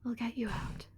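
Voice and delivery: soft unvoiced whisper; whispered promise. Spider Queen to the trapped ones, a whispered promise. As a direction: breathe a promise of rescue.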